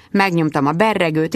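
usual speaking voice

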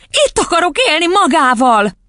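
put-on high, insistent little-girl voice; high-pitched willful plea; stubborn child. A narrator's reading. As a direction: dig in like a child. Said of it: "makacskodott Matilda".

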